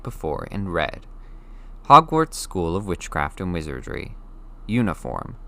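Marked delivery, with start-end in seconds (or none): none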